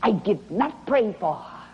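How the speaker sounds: normal talking voice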